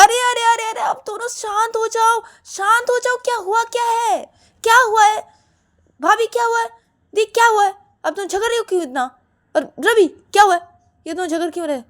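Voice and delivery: very high, loud insistent shouting; shouting for calm. Acted. Rahul stopping the two women's quarrel, shouting. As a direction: shout them down, demanding what happened.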